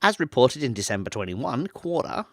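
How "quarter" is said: lightly put-on Australian accent. Shown as a demonstration. Reader slips while reading a report, light Australian accent. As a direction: say it with a touch of Australian. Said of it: "said that in an Australian accent".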